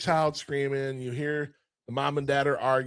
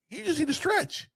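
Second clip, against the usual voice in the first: put-on much higher helpful kid; 14 semitones higher than usual. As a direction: pipe it up like a kid. Kid advises ogre.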